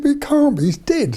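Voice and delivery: high-pitched mock-shocked objection; retold disbelief. Friends answer the girl about the pictured man, retold disbelief. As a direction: object in astonishment, voice shooting up.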